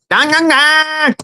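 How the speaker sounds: very high, slow squeaky chant; mock-squeak the gang call; mock tough gangster. Guest mocks a high squeaky gang chant. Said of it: "you know".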